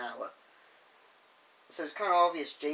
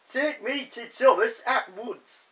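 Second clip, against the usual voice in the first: loud, slow, high imitated Leeds accent; 7 semitones higher, 10 dB louder than usual. Fat Controller demanding to see Thomas, mock Leeds accent. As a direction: command it in a put-on Leeds accent. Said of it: "the Fat Controller sort of Leeds accent".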